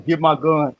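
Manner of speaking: put-on Southern accent. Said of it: "Texas or, or Southern accents".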